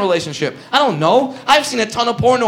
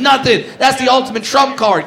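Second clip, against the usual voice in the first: usual speaking voice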